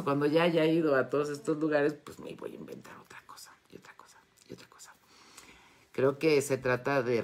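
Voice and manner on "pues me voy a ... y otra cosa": fast, secretive whisper; hushed playful whisper of plans. Acted. Drops to a secretive aside.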